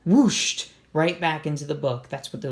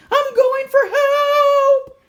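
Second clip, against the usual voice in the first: much higher and louder, stretched slow, almost sung; frightened call; 10 dB louder, much slower than usual. Acted. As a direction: wail it out while running away. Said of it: "he called over his shoulder".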